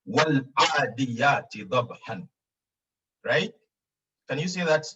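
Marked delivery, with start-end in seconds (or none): 0.0-2.3 slow, drawn-out Quranic recitation. Shown as a demonstration. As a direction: recite each syllable slowly and clearly. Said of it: "it says".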